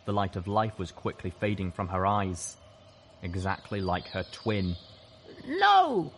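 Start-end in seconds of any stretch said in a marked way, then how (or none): 5.5-6.1 very high, loud anguished cry; anguished whimper. A narrator's reading. Narrator sees a friend shot, anguished high cry. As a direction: wail it in shock, pitched far up. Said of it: "I whimpered".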